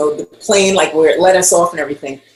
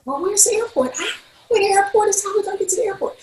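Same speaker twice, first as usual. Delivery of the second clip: much higher-pitched mock worry; retold fretful questioning; 13 semitones higher, 4 dB quieter, much faster than usual. Colleagues planning an airport search, high-pitched fretting.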